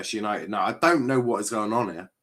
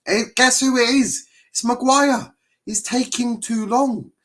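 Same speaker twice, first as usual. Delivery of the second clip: much higher and louder, mock announcing; riled commentary; 14 semitones higher, 5 dB louder than usual. Real, not acted. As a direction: pitch it up like a jeering reveal. Mocking Maguire's slowness, voice raised.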